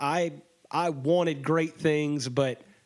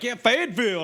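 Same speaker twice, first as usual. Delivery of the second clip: louder, higher hyped-up; mock excited; 7 semitones higher, 4 dB louder than usual. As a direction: copy the friend's excitement. Mimicking friend's excitement.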